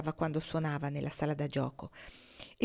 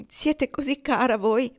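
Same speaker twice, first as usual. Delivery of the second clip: higher, louder and slow; heartfelt thanks; 7 semitones higher, 6 dB louder than usual. Acted. Ortensia thanking Kendra for her kindness, slow and heartfelt. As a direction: draw it out with teary gratitude.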